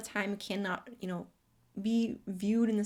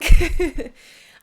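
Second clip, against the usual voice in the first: very loud, high tearful laughter; 7 semitones higher, 21 dB louder than usual. Real, not acted. Vlogger laughing off her tears to viewers, loud tearful laugh. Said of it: "I'm gonna leave that in there".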